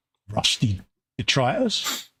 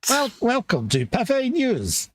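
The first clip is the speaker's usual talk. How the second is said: newsreel announcer; 10 semitones higher than usual. Pretend. Mock Pathé newsreel intro.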